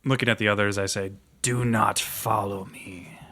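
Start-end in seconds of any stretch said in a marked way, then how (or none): 1.4-3.2 slow threat. Acted.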